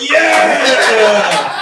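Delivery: a loud, laughing yeah from eager dudes; imitating a dozen dudes shouting; dudes yelling. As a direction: holler like a guy who wants the money. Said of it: "a dozen dudes were like".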